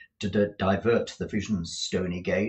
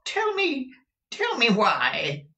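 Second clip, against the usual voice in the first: pleading Scrooge; 12 semitones higher, 4 dB louder than usual. Acted. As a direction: beg for an answer in fear. Scrooge begging the ghost.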